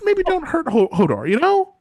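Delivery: high, loud and laughing plea; sweet pleading maester. Retold or imitated. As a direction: beg sweetly for Hodor, laughing. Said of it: "it's like".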